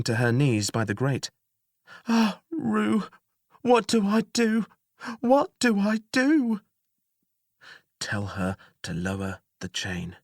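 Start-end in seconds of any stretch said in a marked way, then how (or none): 2.1-6.6 high, weeping despair. Acted. Jailer weeping at the grate. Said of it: "She began to weep". 8.0-10.2 soft, low instruction; quiet. Acted. Tuo quietly instructing the narrator.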